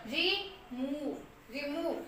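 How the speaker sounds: रिमूव slow and soft, long मू; slow soft demo. Shown as a demonstration. Teacher slowly repeats रिमूव for students. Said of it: "वी से हम वह बोल रहे हैं".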